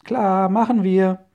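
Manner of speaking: loud, high, drawn-out shout back; high-pitched, slow holler in reply; calling back. A narrator's reading. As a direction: call back cheerily across a distance, stretching the words. Boys call back to Onkel Titus.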